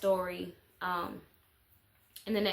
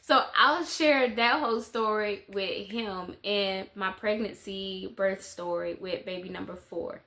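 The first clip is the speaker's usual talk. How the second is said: ordinary delivery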